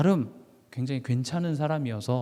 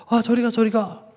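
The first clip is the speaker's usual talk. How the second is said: higher, mock-panicked shooing; alarmed shooing; 7 semitones higher, 4 dB louder than usual. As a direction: act out waving off a dog. Acted shooing of approaching dog.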